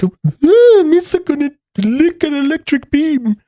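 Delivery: high silly Gungan voice; squeaky alien babble; Jar Jar voice. Retold or imitated. Mocking Jar Jar's voice.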